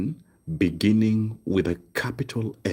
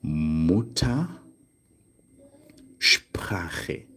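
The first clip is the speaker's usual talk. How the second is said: Mutter, long pause, then Sprache; split compound; 3 semitones lower, much slower than usual. Shown as a demonstration. Teacher showing learners mother tongue, split compound. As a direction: say Mutter and Sprache as two slow, separate parts. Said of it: "you would now say".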